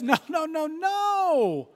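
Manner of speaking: high, slow "no, no, no"; emphatic Paul. Retold or imitated. Paul reassuring the worried Philippians, high and slow. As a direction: firmly wave off their fear.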